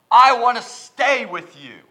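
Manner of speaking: loud, slow, high begging plea; pleading man. Acted. Preacher voicing the healed man begging Jesus, pleading. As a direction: beg to stay like a desperate man.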